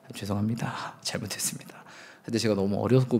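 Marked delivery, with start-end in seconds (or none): 0.1-1.8 hushed, humble apology trailing into a whisper; soft apology. Pretend. Preacher rehearsing an apology to his old pastor, hushed. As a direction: apologize softly, head bowed. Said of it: "꼭 사과를 좀 드리고 싶어요".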